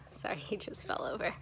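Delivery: soft quick whisper, half laughing; whispered aside. Real, not acted. Mom whispering an aside to viewers mid-story, quick and hushed. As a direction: drop to a whisper and brush it off. Aside about something falling nearby.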